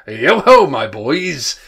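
jovial, booming holler, starting high; Fezziwig. A narrator's reading. Fezziwig hailing his boys, loud and jovial. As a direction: holler like a jolly master rallying the lads. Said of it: "comfortably rich, oily, fat, jovial voice".

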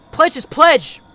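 high, loud cult call; loud declaration. Retold or imitated. Friend confronting Sailor outside, loud high call. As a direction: call out the slogan forcefully.

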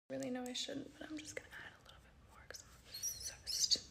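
barely audible whisper; hushed, almost silent confession; whispering on the phone. Real, not acted. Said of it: "I have to whisper".

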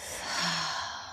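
long, airy sigh out through the mouth; slow cleansing out-breath; demonstrated exhale. Shown as a demonstration. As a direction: let the breath go in a soft open-mouthed sigh. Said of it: "Exhale through the mouth".

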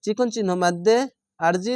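ordinary delivery

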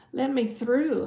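mild urgent shout; crying out. A narrator's reading. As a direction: call out to get through a crowd. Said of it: "I cried".

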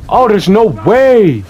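can't believe what just happened; disbelieving shout. Real, not acted. Disbelief at game moment.

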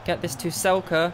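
ordinary talk, nothing marked

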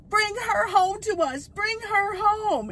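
high, slow, tearful pleading as his mom; imitating his tearful mom. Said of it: "she was crying".

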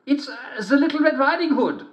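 high, poorly faked girl voice; wolf badly faking a little girl; wolf at the door faking the girl's voice; bad imitation of a girl. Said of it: "not very good imitation".

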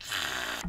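voiceless gasp of awe; swooning gasp. Retold or imitated. Fan reacting to Garrus saying he loves Shepard, swooning gasp. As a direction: gasp as if overcome.

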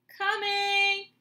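loud, high shout; shouting back. Acted. Annie answers Dad from the treehouse, shouting loud and high. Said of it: "Annie shouted".

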